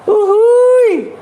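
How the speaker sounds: falsetto, loud cheer; a sudden high hype whoop. Real, not acted. Streamer cheering to his chat, high loud whoop.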